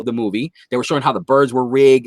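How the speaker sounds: ordinary delivery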